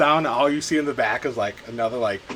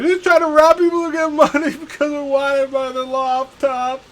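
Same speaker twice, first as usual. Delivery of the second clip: high, loud panicked babbling; begging for my life; 9 dB louder, slower than usual. Acted. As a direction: gabble excuses in a panic. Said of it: "starts pleading for his life".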